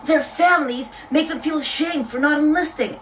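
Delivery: imitating an American girl; mock American accent. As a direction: mimic her in an American accent. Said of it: "I don't know why I'm giving her this accent".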